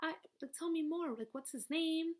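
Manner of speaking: put-on high, eager girlfriend voice; excited friend. Old friend hearing news, high and eager. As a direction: pitch it up like a gossiping friend. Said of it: "and she's like".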